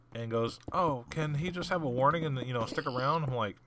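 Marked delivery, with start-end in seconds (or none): 0.7-3.2 higher whiny pleading imitation; imitating a whiny friend; mock pleading friend. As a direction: mimic the buddy begging, higher. Mimicking the pleading buddy.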